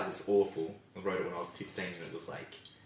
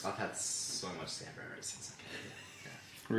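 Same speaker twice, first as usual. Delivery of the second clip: whispering; 2 semitones lower, 13 dB quieter, slower than usual. Real, not acted. Quiet aside while others chat.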